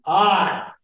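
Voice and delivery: "I" said high and loud; stressed I. Shown as a demonstration. Teacher demonstrates a stressed "I" to the class. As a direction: lean hard into "I". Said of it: "It would really be".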